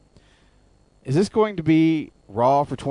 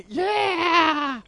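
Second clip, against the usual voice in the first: high shrieking yeah. Pretend. Hammed-up shriek like Howard Dean.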